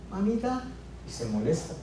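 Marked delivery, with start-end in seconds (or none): 0.1-0.6 lightly voiced son, high and soft; brother on the phone. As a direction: imitate brother calling mum, higher and softer. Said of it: "a ese muchacho que sigue de mí".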